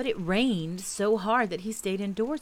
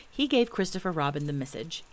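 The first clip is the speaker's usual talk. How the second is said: ordinary delivery, nothing marked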